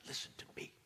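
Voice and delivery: soft, hushed whisper; God whispering through the noise. Acted. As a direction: breathe it as the quiet voice that cuts through. God's voice breaking through noise.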